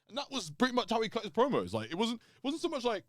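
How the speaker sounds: ordinary delivery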